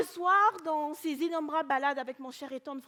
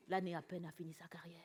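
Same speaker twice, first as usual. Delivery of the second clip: low, soft recital of Romantic verse; poem recitation; 7 semitones lower, 9 dB quieter than usual. Acted. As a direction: recite Lamartine low and softly.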